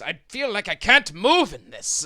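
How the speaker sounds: high, loud character complaint; complaining tux wearer. Acted. Man coming downstairs in a stiff tux, loud complaint. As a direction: push it high and loud, acted exasperation.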